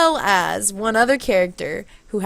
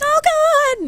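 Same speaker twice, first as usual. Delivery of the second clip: very high, frightened girl imitation; mock panic; 4 dB louder than usual. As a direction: squeal in pretend fright. Retold girl facing a gun.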